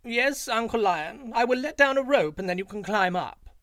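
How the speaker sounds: high, loud fawning jackal voice; sly jackal. A narrator's reading. Jackal agrees to Uncle Lion, high and fawning. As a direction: play the crafty little jackal, all eager to please.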